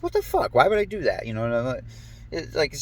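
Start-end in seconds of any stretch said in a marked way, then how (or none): 0.0-1.3 high, startled disbelief; baffled. Retold or imitated. Retelling his own reaction, suddenly high and incredulous. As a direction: pitch jumps way up in shock, as if caught off guard. Baffled at doing strange things.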